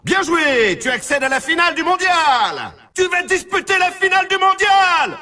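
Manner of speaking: high, triumphant announcer shout; excited congratulations. Acted. Announcer tells the player they reached the world final, shouting excitedly. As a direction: hail the player with soaring pitch.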